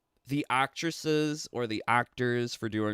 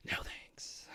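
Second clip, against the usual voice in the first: whisper; 16 dB quieter than usual. Real, not acted. Dismissing hopes pinned on Cyrax.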